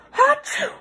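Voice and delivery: hammed-up achoo; big sneeze. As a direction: sneeze right at the reader. Monster sneezes at reader.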